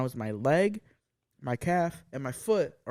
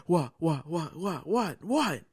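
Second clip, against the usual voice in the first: higher-pitched rapid-repeat irritated questioning; Belinda, mad; 4 semitones higher, slower than usual. Retold or imitated. Imitating partner woken at night, mock annoyance. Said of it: "Like, she was mad".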